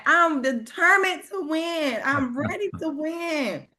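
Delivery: squeaky high mock chant; mock yelling along. Cohost mimics yelling along with the video, squeaky mock chant. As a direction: ham up the affirmation. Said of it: "I'm like".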